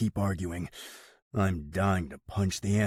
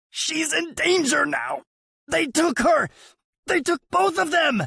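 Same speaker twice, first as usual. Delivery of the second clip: very high, loud desperate warning; urgent desperate; 7 dB louder than usual. A narrator's reading. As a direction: warn him with the last breath. Brother pleads urgently.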